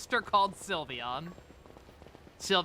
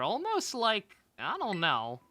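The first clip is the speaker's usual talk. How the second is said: ordinary delivery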